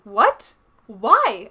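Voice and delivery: sudden loud panicked cry. A narrator's reading. Narrator panicking at boyfriend's breakup call, loud and alarmed. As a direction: blurt it out in a panic. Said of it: "I panicked".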